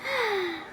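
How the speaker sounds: a high, soft weary sigh; long sigh. A narrator's reading. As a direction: let out a tired sigh. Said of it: "she said with a sigh".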